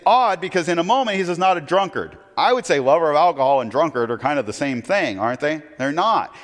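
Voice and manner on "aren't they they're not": ordinary delivery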